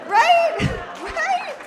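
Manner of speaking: sharply high, pressing for agreement; high-pitched insistent right; high emphatic right. Real, not acted. Crowd-work emphasis.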